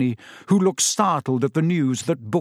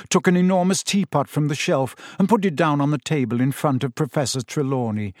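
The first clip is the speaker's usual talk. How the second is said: ordinary delivery, nothing marked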